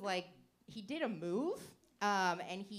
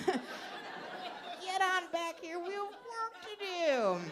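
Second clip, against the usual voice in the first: high, slow, drawn-out laughing call; mock bossy call; 11 semitones higher, much slower than usual. Comedian calls the guy back to bed, high slow laughing summons.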